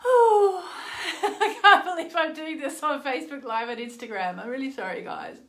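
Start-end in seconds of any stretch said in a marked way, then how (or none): 0.0-4.4 laughing sheepishly. Real, not acted. Embarrassed laughing while live streaming.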